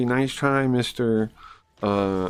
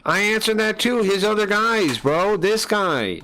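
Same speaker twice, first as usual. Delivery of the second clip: insistent and higher; exasperated; 8 semitones higher than usual. Real, not acted. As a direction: keep insisting, fed up. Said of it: "Oh, I'm so annoyed with that".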